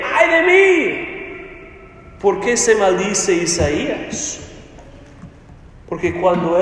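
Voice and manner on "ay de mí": high, drawn-out exclamation; incredulous echo. Acted. Preacher asking who would ever say this, incredulous. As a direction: cry it out slowly, pitched up in disbelief.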